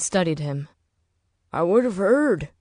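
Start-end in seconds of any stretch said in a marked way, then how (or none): 1.5-2.5 insisting. Acted. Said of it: "he insisted".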